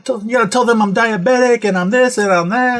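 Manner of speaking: mildly mocking mother voice; lightly voiced mom. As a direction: imitate mom listing her ailments. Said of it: "Yeah, she's like".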